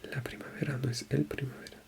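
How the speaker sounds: quick whispered self-correction; fast whisper. Real, not acted. Learner correcting herself under her breath, fast whisper. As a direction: rush it out in a whisper. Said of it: "whispered correction to herself".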